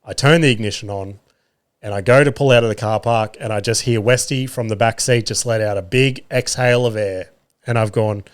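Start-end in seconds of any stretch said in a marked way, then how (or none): none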